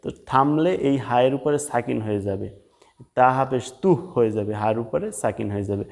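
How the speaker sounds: ordinary talk, nothing marked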